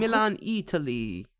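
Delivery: put-on Italian lilt, stretched out; mock Italian accent. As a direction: ham up an Italian accent, slowly. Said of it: "accent-wise".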